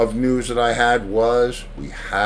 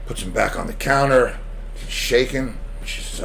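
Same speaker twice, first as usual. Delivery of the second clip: normal talking voice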